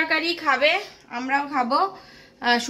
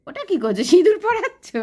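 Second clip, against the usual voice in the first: surprised; 5 semitones higher than usual. Real, not acted. Child plays with vermilion.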